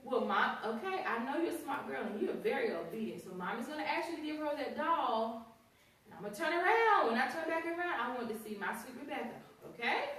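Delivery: put-on high, sing-song coaxing of a child; sweet coaxing. Teacher coaxing a little girl to give back a doll, high and sweet. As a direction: talk a toddler round gently.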